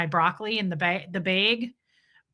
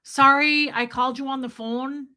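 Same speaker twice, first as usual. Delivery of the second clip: Debbie Zito; 4 semitones higher than usual. Retold or imitated. Friend calling the host on the phone, Minnesotan accent. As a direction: lean into round Minnesotan o's. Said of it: "Debbie Zito will call me and be like".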